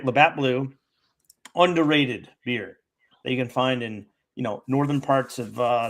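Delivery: ordinary talk, nothing marked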